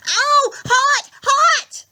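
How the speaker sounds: high, loud yelps of pain. A narrator's reading. Grover yelps as the whip burns him, high and loud. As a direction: yelp as if burned. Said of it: "he yelled".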